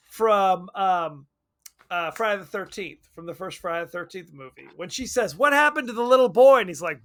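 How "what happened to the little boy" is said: quick, higher, louder woman's question; quoting the woman. Retold or imitated. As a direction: ask it fast and alarmed. Woman's line from the movie.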